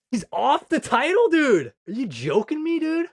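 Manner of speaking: high, loud disbelieving cry; can't believe the news, blurting it out high; shocked outburst. Real, not acted. Streamer hearing the artist is leaving, shocked high shout.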